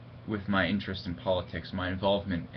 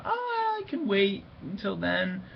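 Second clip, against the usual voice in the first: high-pitched, drawn-out musing to oneself; airy quoted thought; 12 semitones higher, slower than usual. Retold or imitated. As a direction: lift way up and stretch it, imitating a carefree thought. Voices own past thought.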